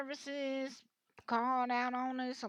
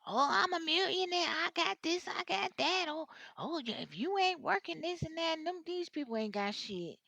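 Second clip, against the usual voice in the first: higher and louder, hammed-up bragging; mock showing off; 7 semitones higher, 5 dB louder than usual. Reader mocking online scammers who pretend to be rich, hammed-up boast. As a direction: play the fake millionaire puffing up. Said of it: "how they be acting like".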